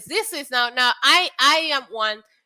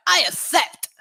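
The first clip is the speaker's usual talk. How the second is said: badly faked A J Styles accent, pitched up; higher, hammy take on the A J Styles accent; 6 semitones higher than usual. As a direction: put on a horrible imitation of his accent. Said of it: "That's a horrible A J Styles accent".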